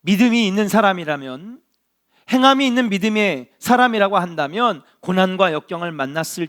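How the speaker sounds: 믿음이 hit loud with a rising tune; preaching at full force. Acted. Pastor pressing the congregation on faith, loud rise. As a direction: lift 믿음이 up and out.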